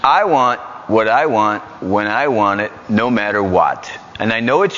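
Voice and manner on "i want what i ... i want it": ordinary talk, nothing marked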